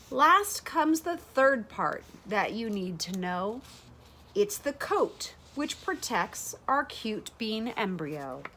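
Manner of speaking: plain reading voice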